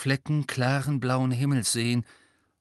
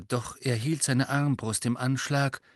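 ordinary delivery

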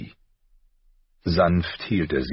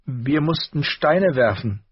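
dejected confession; 10 semitones higher, 4 dB louder than usual. A narrator's reading. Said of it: "gestand sie bedrückt".

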